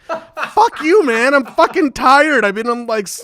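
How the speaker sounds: high, loud exasperated retort; mock angry yell. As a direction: yell back at the fans in fake fury. Retorting to German fans.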